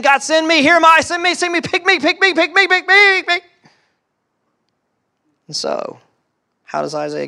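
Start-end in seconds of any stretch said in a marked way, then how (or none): 0.0-3.4 high, loud, eager begging to be chosen; eager pleading. Retold or imitated. Preacher urging the church to beg God to pick them, high and loud. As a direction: plead like a kid wanting the game. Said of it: "where's our excitement saying".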